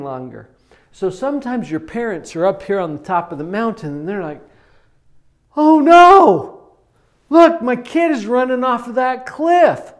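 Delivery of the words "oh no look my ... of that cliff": high, loud panicked alarm; worried parent yelling down in alarm; freaked-out parent. Pretend. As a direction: panic as you spot your kid near the cliff. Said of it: "they freak out just a little bit".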